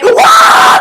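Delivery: high, loud mock scream; sudden yell as if zapped; electrocuted scream. Chelios shocked on the pole, high loud scream.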